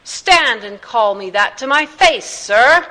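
loud circus showman, rising on the last word; booming challenge. A narrator's reading. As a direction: boom out a dare to an opponent. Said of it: "boomed Philip Astley's unmistakable circus voice".

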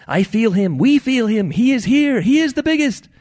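high, loud, building shrimp testimony; proclaiming shrimp. A narrator's reading. Shrimp testify to Ed about the fish, proclaiming. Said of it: "The shrimp responded".

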